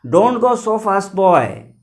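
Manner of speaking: a loud call to someone at a distance. Retold or imitated. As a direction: call after a boy hurrying past. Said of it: "called after me".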